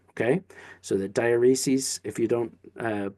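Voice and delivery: ordinary delivery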